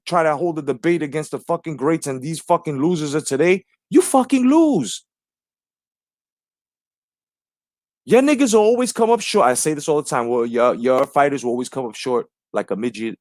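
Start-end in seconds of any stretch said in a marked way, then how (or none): 3.9-9.5 taunting. Real, not acted. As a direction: rub it in, pitch way up. Taunting rival fans.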